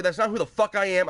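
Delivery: ordinary delivery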